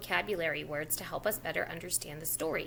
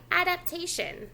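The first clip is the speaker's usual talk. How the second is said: "Adaptation" said higher and clear; modelled word; 6 semitones higher than usual. Shown as a demonstration. Teacher modelling "Adaptation" in a higher voice. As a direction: lift "Adaptation" up as the model for the child. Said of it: "You try".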